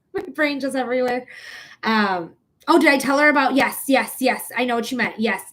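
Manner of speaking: normal talking voice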